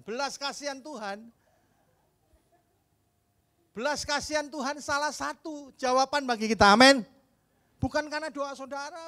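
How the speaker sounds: loud, high-pitched preaching cry; full-force preaching. Acted. Preacher proclaiming God's mercy to the church, loud and high. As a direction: proclaim it to the whole hall.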